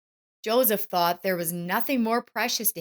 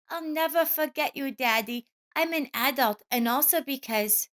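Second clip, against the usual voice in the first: put-on child's voice; 8 semitones higher than usual. A narrator's reading. Child answers father.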